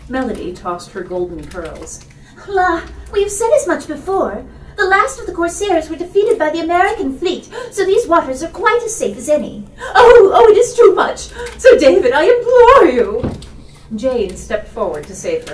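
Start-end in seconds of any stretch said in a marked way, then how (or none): 2.5-9.7 put-on high, lightly exasperated girl's voice; airy young lady. Daughter corrects her mother, high and airy. 9.9-13.3 very high, loud, laughing wail; hysterical mother. Acted. Mother implores Sir David, very high and loud.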